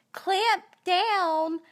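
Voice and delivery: high, loud mock-chirpy "Clamp down"; a sing-song bossy nurse; imitating the nurse. Nurse ordering her to bite the gauze, mock chirpy. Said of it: "they say it in a tone".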